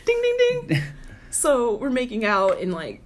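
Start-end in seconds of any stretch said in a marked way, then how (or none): 0.1-0.8 very high, louder sing-song ding; playful imitated bell; mock bell ring. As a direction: chime like a game-show bell. Mimics a winning bell.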